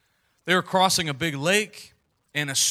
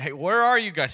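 calling out; 5 semitones higher, 6 dB louder than usual. Acted. God calling Adam and Eve.

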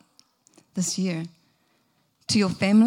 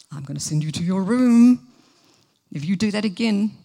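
louder, tired repeated threat; weary parent; 5 dB louder than usual. Retold or imitated. Parent threatening again, louder and wearier. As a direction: run the threat out once more. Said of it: "Next minute".

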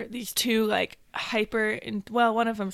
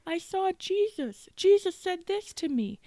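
imitating crying kid; 8 semitones higher, 9 dB louder than usual. Third graders crying after adoration.

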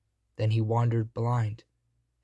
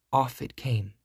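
ordinary delivery, nothing marked

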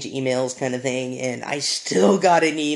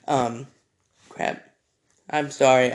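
ordinary delivery

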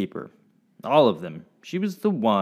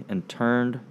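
usual speaking voice

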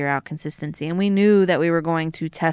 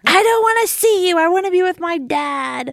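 put-on high, loud whiny kid voice; be a sulky boy rejecting mom; upset child; 12 semitones higher, 6 dB louder than usual. Mom imitating her son rejecting her after his dad's, high loud whine. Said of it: "my son comes home".

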